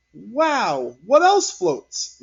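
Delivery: high, slow wondering; amazed teacher. A narrator's reading. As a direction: play up the surprise for the kids.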